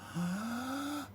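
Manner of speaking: long slow audible inhale; deep breath in. Shown as a demonstration. Mum shows Diego dragon breathing, long inhale. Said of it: "breath in".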